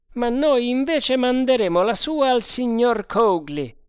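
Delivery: loud, high-pitched retort; spirited sergeant. A narrator's reading. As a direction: throw it back high and forceful. Sergente ribatte al capitano. (ribattuta da sergente, acuta e forte)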